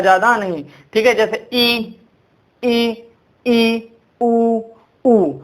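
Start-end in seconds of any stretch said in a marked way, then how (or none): 1.5-3.9 ई held level with the mouth barely open; nearly closed vowel. Shown as a demonstration. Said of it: "थोड़ी सा खुलता है". 4.2-5.3 उ held with the mouth barely open; nearly closed vowel. Shown as a demonstration. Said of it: "थोड़ी सा खुलता है".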